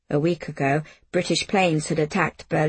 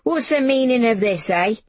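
higher, indignant mother's voice; narrated angry confrontation; angry demand; 5 semitones higher, 3 dB louder than usual. A narrator's reading. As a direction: demand an explanation, accusing. Angry mother confronts daughter with letter.

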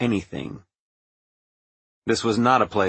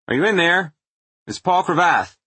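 higher, raised voice through a door; calling out; 7 semitones higher, 3 dB louder than usual. A narrator's reading. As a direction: call out to someone unseen. Calling into a dark room.

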